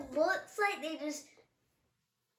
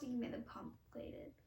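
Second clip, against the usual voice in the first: whisper; 2 semitones lower, 14 dB quieter than usual. Real, not acted. Whispered aside.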